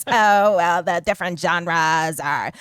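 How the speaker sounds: mocking creaky interviewer drawl; vocal fry voice. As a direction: imitate the annoying vocal fry. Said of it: "in their annoying vocal fry voice".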